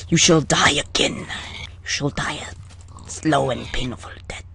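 low, menacing villain whisper; mock villain. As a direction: threaten them like a dark lord. Menacing a mob.

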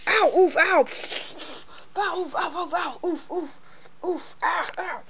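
high mock hurt yelps; pained ow and oof sounds. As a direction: yelp as if hit. Characters getting hit in fight.

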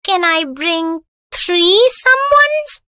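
put-on high, playful child voice; playful little girl. A narrator's reading. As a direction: ask cheekily as a small child. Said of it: "asked Jaya playfully".

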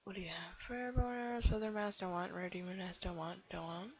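ordinary talk

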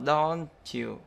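slow "don't you" linked as don-chew; nối âm ch. Shown as a demonstration. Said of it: "nhớ nối âm nhá". (đọc chậm "don't you" thành đon-chiu)

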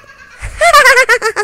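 high, loud burst of laughter; nervous high laugh. Real, not acted. Gamer laughs loudly after a scare. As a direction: laugh out the fright.

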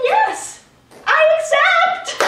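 high, loud, slow delighted yes; overjoyed acceptance. Acted. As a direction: gush the acceptance like a proposal. Accepting a role never offered.

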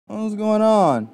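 ordinary talk, nothing marked

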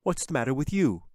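irked, pitch jumping up; annoyed. A narrator's reading. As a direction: ask it with open annoyance. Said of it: "obviously annoyed".

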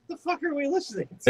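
high, fast baffled quote. Retold or imitated. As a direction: squeak it out in disbelief. Quoting friend's bafflement.

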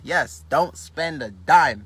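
very slow, deliberate reply; calmly insisting; slow emphatic. Real, not acted. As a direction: spell out each word as final.

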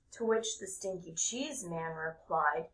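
ordinary delivery, nothing marked

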